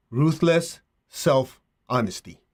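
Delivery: slow, with pauses between the words; slow dictation. Real, not acted. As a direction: give it word by word to be written down. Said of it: "and write this down".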